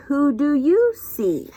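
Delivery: usual speaking voice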